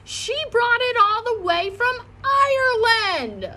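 put-on high, slow scolding child voice; hollering. Lucille scolding Junie about the linen cloth, high and slow. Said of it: "hollered Lucille".

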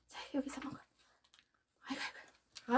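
soft whisper to the dog; hushed treat whisper. Real, not acted.

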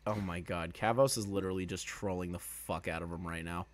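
ordinary talk, nothing marked

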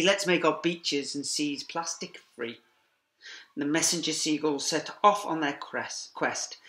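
ordinary delivery

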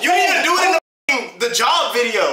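loud, high heated yelling; shouting. Real, not acted. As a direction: yell it over him in the argument.

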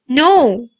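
mild hissed refusal; understated hissed no. A narrator's reading. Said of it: "hissed Harry".

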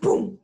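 sudden loud pum; loud crash boom. Acted. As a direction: hit it like a crash. Crash sound. (golpe fuerte)

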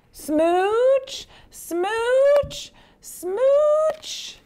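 very high, loud smooches, climbing each time; happy fish smooches. Acted. Kiss-kiss fish hands out kisses, rising squeals.

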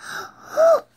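sharp high gasp of fright; startled gasp. Real, not acted. Child spotting the spider in the book, startled gasp.

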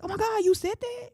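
very high squeal of disbelief; hammed-up astonished squeak; acted shock. Speaker reenacting hearing what Jesus said, shocked. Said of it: "I'm shocked".

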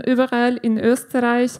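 usual speaking voice

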